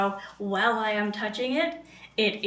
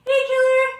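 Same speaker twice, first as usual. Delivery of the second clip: calling out; 10 dB louder than usual. Real, not acted. Greeting a viewer loudly.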